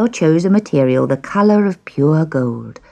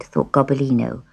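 ordinary delivery

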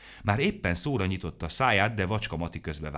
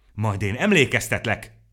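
loud, higher screeched threat; screeching; 5 semitones higher, 10 dB louder than usual. A narrator's reading. Bruckner Siegfried screeching at Ló Serafin, loud. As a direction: squawk the words out loud. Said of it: "rikácsolt Bruckner Siegfried".

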